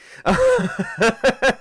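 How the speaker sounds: a burst of high-pitched real laughter. Real, not acted. GM laughs at own joke about the dead character, high laugh. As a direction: crack up loudly.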